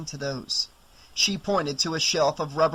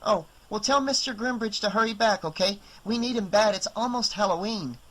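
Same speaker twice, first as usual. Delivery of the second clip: put-on higher kid's voice, polite; young boy; 6 semitones higher than usual. Boy asks Ellie for the owner, higher kid's voice. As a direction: play a small boy asking politely.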